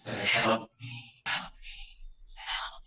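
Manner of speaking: breathy, spooky imitated cry for help; thin ghostly pleading, hammed up; mock eerie plea. Clay head begging kids, mock eerie.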